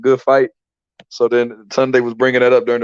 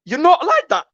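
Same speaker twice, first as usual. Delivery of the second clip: much higher, put-on accent; imitating Tunde. Retelling Tunde to [name], high accented voice. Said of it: "what he said to Pat".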